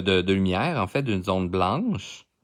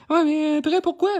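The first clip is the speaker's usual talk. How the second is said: much higher, tiny cartoonish voice; little voice; 3 dB louder than usual. Redoing the question for viewers in a tiny high voice. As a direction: squeak it out like a small creature. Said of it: "J'ai oublié de prendre ma petite voix".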